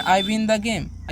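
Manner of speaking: "I win the game" said very slowly, word by word; slow teacher dictation. Shown as a demonstration. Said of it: "जैसे मैं".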